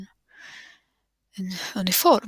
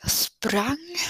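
breathless whisper; 4 semitones higher than usual. Real, not acted. Recounting fleeing the corpse.

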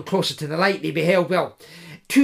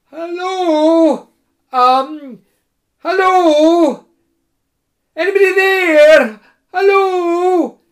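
loud, high calls into the emptiness; calling out; 13 semitones higher, 11 dB louder, slower than usual. Acted. Koma calling out across the empty plain, high and loud. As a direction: shout hello into the wild land. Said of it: "Koma tried to shout".

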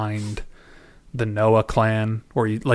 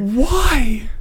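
very high, drawn-out baffled cry; exasperated disbelief; 13 semitones higher, 4 dB louder than usual. Real, not acted. Podcast host questioning characters' absurd habit, exasperated disbelief. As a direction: wail the question in comic bafflement.

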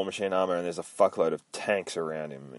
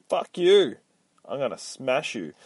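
imagining telling off soldiers, mock furious; defiant; 6 semitones higher than usual. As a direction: snap back like you're ready to fight. Imagining defying land-grabbing soldiers.

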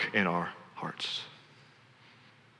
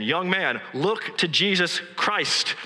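retold preacher's shout; 6 semitones higher, much slower than usual. Lay preacher calling to a young man under the gallery, mildly shouted. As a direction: draw out the call slowly, a restrained shout. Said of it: "he shouted as only a primitive Methodist can".

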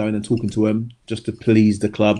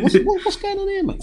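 puzzled inner voice. Retold or imitated. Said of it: "So I'm thinking".

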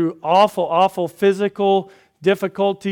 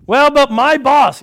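mock complaint; 8 semitones higher, 11 dB louder than usual. Preacher voices a complaining listener.

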